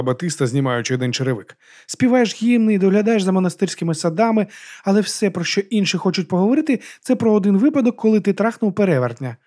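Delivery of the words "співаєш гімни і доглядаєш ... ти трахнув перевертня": high-pitched needling voice; lifted, mocking character voice; teasing Batista. A narrator's reading. Batista teases the monk.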